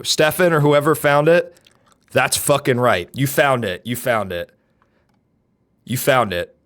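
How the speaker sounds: ordinary delivery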